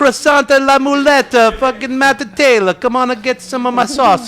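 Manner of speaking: high, slow hammed-up French accent; drawing each word out as a cheesy Frenchman, pitched high; mock French accent. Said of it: "can you do the French accent".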